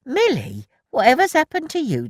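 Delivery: high, loud startled question; surprised. A narrator's reading. Elsie startled by muddy Milly, high surprised. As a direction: exclaim at the mess.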